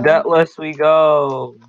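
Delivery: slow, drawn-out heroic call; rally the party like a hero; heroic battle cry. Pretend. Battle cry again, then whee.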